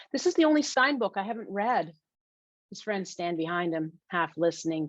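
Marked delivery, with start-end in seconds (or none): 0.1-2.0 higher-pitched, coaxing appeal; pleading. Acted. Emmett begging Ava to lend him the book, pleading. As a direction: plead your case, pitched up. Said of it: "he pleads".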